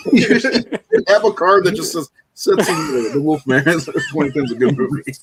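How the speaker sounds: high laughing words. Real, not acted. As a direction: giggle through the line. Laughing while proposing card.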